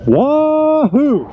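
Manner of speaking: high, loud whoop of delight; joyful whoop. Real, not acted. Rider whoops after a thrilling test ride, high and loud. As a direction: let out a thrilled yell.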